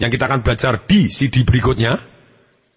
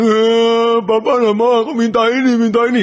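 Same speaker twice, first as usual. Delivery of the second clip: high, loud mock wail of a kid; hammed-up child's crying; 9 semitones higher, 4 dB louder than usual. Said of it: "pada waktu anaknya nangis".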